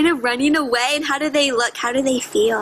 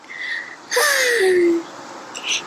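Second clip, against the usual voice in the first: contented sigh; 4 semitones higher than usual. Acted. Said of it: "and he sighs with delight".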